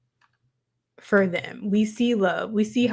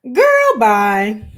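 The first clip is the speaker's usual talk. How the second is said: put-on sassy girl bye; 7 dB louder than usual. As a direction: wave her off with a laugh, pitched up. Sassy dismissal of Sierra.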